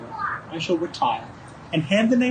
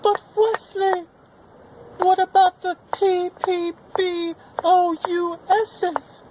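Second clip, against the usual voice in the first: high, slow, fretful plea; anxious princess; 6 semitones higher, slower than usual. Acted. Buttercup asking Wesley about the danger, high and slow. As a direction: plead up high and slowly.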